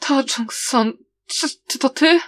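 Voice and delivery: stammering, breaking voice, rising into the question; shaken, halting plea climbing in pitch; trembling, shattered voice. A narrator's reading. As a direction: falter on the name, voice falling apart. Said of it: "jego głos brzmiał, jakby rozsypał się".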